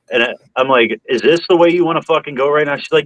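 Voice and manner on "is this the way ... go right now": challenging the cop. Retold or imitated. Retold roadside argument with cop.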